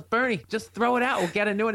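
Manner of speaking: mock wifely plea; retold exasperated mother. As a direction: plead lightly with a stubborn husband. Mother urging dad in retold argument.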